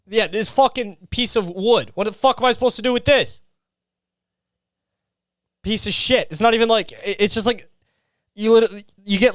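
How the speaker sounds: usual speaking voice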